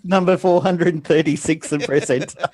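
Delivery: high, loud computer prompt voice; old speech synthesizer. Pretend. Mock floppy disk install prompt.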